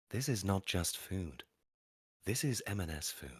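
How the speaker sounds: soft, hushed sexy food-advert voice. Shown as a demonstration. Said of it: "You do the voice and I'll try".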